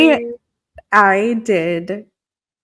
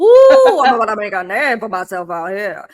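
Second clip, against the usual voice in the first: put-on Gambit Cajun accent; 4 dB louder than usual. Showing Megs the Gambit sound bite in a Cajun accent. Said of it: "the sound bite of Gambit".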